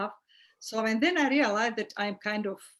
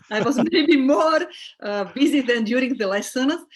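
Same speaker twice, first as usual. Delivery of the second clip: words break into a laugh, louder and higher; 3 semitones higher, 6 dB louder than usual. Real, not acted. Teacher laughing about busy breaks.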